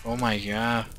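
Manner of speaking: normal talking voice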